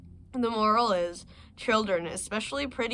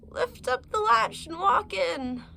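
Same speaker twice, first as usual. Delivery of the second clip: put-on much higher, slow, frail old-lady call; frail grandmother; 12 semitones higher, 4 dB louder, slower than usual. Sick grandmother calls to the visitor, high and slow. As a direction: call out weakly from the sickbed.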